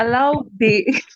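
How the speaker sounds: slow words through a laugh. Real, not acted. Laughing while correcting her own word slip. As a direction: laugh while trying to finish.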